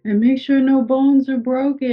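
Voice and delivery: high-pitched, insistent mimicked voice; imitated insistent paramedic. Paramedic insisting the patient's coat come off, high imitated voice.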